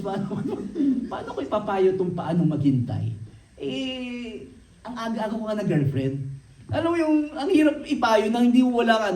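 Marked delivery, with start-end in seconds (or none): 0.0-1.4 a real laugh breaking in, higher. Real, not acted. A youth pastor laughing at himself before the congregation, real laugh. Admits early girlfriend, laughs. 6.7-9.1 talking through a real laugh, higher and louder. Real, not acted. A preacher laughing at giving advice he never followed, through laughter.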